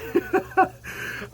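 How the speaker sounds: breathless laugh. Real, not acted. Drooling from pepper.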